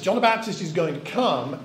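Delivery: normal talking voice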